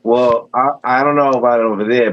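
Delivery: ordinary delivery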